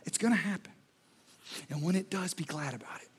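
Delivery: soft, low reassuring voice; calm them like a kind teacher; gentle Jesus. Retold or imitated. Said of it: "Which is why Jesus says".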